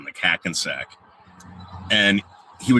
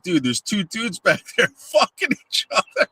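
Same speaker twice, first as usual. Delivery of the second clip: high voice breaking into a laugh; laughing disbelief; 12 semitones higher than usual. Retold or imitated. Coworker explaining what he saw, laughing in a high voice. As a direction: tell it high, half laughing. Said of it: "He's like".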